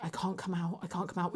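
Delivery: mock-panicked hush from behind the hedge. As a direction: keep it low so nobody hears you.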